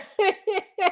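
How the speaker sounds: high, light giggle; laughing. Real, not acted. Amused by a chat comment.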